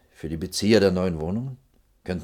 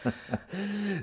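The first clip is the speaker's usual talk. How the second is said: a quick high burst of laughter; short amused laugh; 12 semitones higher, 8 dB quieter than usual. Acted. Official laughing off the young architect's demand, short high laugh. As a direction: laugh her off, half amused.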